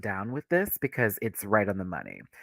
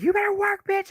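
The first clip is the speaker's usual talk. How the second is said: screamed at her; 3 dB louder than usual. Retold or imitated. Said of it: "screamed at her".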